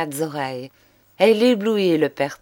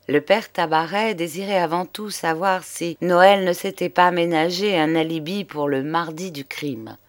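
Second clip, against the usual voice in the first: usual speaking voice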